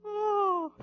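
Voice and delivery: a high, soft, sinking oh; deflated oh. Retold or imitated. Watching the bus leave without him, a high soft deflated oh. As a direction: let the hope drain out of it.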